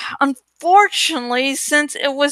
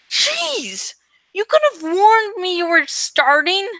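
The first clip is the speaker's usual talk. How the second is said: jumps high in alarm, then complains; startled yelp; 5 semitones higher than usual. Acted. Narrator startled by friend's sudden antennae, high alarmed yelp. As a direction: blurt it out, shocked.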